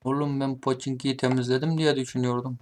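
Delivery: ordinary delivery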